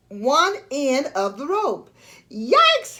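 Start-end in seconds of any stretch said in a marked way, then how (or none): 2.3-3.0 high, sharp startled yelp; startled shout. Acted. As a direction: yelp it in sudden alarm. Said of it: "he shouted".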